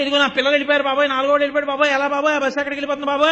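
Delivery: loud, fast panicked wail; frantic wailing. Retold or imitated. Mother shouting to everyone that her child is gone, frantic fast wail. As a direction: rattle off the cries in a frantic rush. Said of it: "అని అరవడం ఎలాగో".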